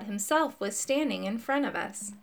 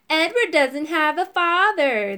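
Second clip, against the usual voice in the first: put-on higher, louder kid jeer; taunting yell; 6 semitones higher, 5 dB louder than usual. A narrator's reading. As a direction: jeer it at him together. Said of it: "they yelled together".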